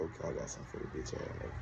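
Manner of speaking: fast, hushed whisper; whispered threat. Retold or imitated. Planning payback on the staring cellmate, quick whisper. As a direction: plot it quietly to yourself. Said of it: "I said".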